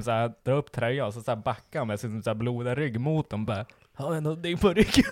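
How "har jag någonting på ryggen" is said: lightly voiced bloodied friend. Tim asks with bloody back.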